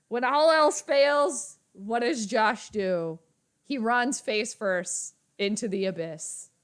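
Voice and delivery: slow, grand stage declamation; mock dramatic monologue. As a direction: declaim it slowly, starting big and settling down. Mock theatrical speech about the quarterback.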